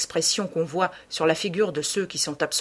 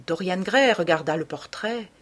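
ordinary delivery

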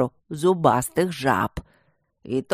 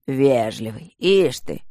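displeased drone; 4 semitones lower, slower than usual. A narrator's reading. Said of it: "прогудел недовольный голос".

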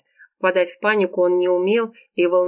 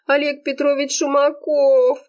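high, long-drawn wail of the name; moaning lament; 9 semitones higher than usual. A narrator's reading. Serafima names her beloved boss, moaning. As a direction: wail the full name, stretching it out. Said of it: "продолжала стенать Серафима".

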